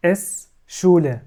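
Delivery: شولة said slowly, its S sounded as sh; slow German pronunciation. Shown as a demonstration. Teacher to students, showing the S as sh, slowly. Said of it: "بتنطق زي الشين".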